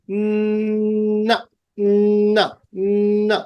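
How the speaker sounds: উম, না through the nose, mouth closed; nasal demo. Shown as a demonstration. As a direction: hum উম then না nasally. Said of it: "নাক দিয়ে বের করো". (নাক দিয়ে উম, না)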